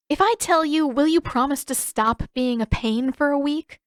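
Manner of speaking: a lightly voiced exasperated Katara. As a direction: strike a deal, a bit exasperated.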